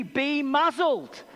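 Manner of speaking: slow, stern "muzzled"; authoritative command. Acted. Jesus ordering the raging sea, slow and authoritative. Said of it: "Real authoritative thing".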